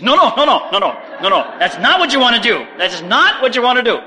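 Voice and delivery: loud, high, repeated objection; emphatic, alarmed head-off; urgent restraint. Retold or imitated. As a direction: rein in an overeager student. Teacher urgently restraining eager student.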